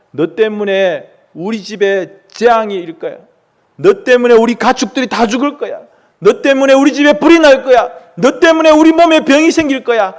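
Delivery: building from steady anger to a loud, high shout; angry ranting. Acted. Father blaming his son for coming disaster, building furious shout. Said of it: "아빠가 막 화를 내는 거예요".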